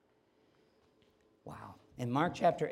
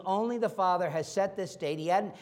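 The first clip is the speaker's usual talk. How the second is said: normal talking voice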